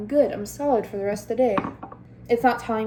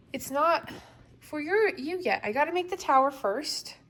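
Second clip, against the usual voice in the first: higher-pitched pet-talk voice; talking to dog; 6 semitones higher than usual. Real, not acted. Owner to dog sitting on the blanket, higher pet talk. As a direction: gently explain it in a higher voice.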